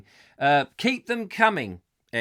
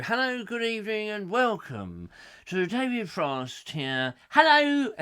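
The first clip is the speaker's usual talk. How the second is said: smooth, slow TV host greeting; David Frost impression; 6 semitones higher than usual. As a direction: greet like a suave chat show host. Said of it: "more Bruce Forsyth than David Frost".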